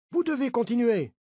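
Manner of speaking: two men bullying the third; aggressive insistence. A narrator's reading. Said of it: "Deux sont très agressifs".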